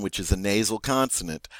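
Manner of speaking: usual speaking voice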